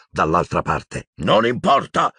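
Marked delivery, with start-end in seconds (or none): 1.2-2.1 high-pitched urgent yell; man shouting. A narrator's reading. A Death Eater yells behind the door, shouting. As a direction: call out forcefully to your band. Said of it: "sentì gridare una voce d'uomo".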